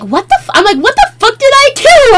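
baffled outrage. Retold or imitated.

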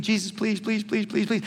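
rapid run of repeated pleas; mildly acted pleading, words tumbling out; imitated begging. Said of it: "this dude is begging, he's pleading".

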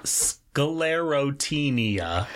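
slow careful Sclerotinia, syllable by syllable; staccato. Shown as a demonstration. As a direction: sound out Sclerotinia slowly. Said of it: "give me syllable by syllable".

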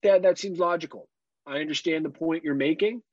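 put-on high, prim voice of a reasonable child; mock a child who suddenly agrees; imagined polite daughter. Father mocks daughter agreeing politely, prim high voice. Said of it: "It's not like".

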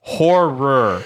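"Horror" slowly in two separated syllables; careful horror. Shown as a demonstration. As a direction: labour over both R's in "Horror". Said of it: "That's a very hard word".

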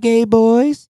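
high, squeaky mock Chow voice; lightly voiced comic farewell; Mr. Chow impression. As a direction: pitch it way up, teasing. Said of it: "where he's like".

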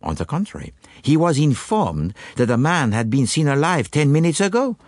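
put-on French-accented detective, lilting and precise; Poirot explaining. Detective answers eager questions after supper, explaining. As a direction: lay out the deductions in a French accent.

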